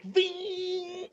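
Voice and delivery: long, high-pitched "ving"; imitated car zoom. Podcaster showing John Wick driving off, imitated car zoom. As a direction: draw out a high mock engine whine.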